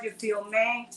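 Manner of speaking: ordinary talk, nothing marked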